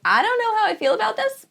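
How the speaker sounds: squeaky high doubtful voice; high mock-unsure tone. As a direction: pitch way up to mock her own first doubt. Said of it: "I was like".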